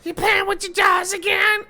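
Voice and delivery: put-on high, thin old granny voice; squeaky nagging grandma. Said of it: "he does this grandma voice".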